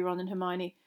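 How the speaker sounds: usual speaking voice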